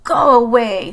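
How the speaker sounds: loud, grumpy dismissal; grumpy Toad. A narrator's reading. Toad in bed dismisses Frog, grumpy and loud. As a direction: send them away in a loud grump. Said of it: "Toad is a little bit grumpy".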